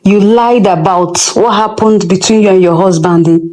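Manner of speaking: usual speaking voice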